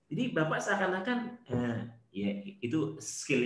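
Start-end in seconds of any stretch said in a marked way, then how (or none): none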